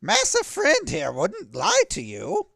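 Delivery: high, loud flustered sidekick; nervous high voice. Acted.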